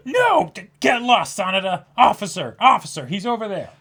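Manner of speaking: high, loud hammed-up yell for the officer; mock outraged shout. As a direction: shoo off the pervert theatrically. Mock outrage calling police.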